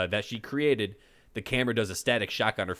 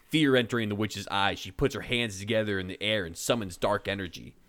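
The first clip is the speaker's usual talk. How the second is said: usual speaking voice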